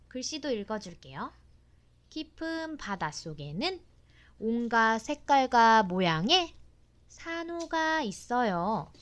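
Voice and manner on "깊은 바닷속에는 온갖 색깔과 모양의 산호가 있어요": put-on reading to a toddler. Mother reads book text aloud.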